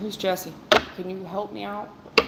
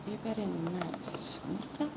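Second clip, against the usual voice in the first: tender plea; 10 dB quieter than usual. Retold or imitated. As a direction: ask it gently, almost a hush. Gentle spirit guide plea.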